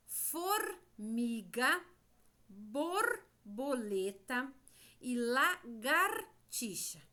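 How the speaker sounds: formiga, borboleta e lagartixa stretched out slowly, syllable by syllable; slow word demo. Shown as a demonstration. Teacher reading the word list to children, slow and drawn out. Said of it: "O som do R".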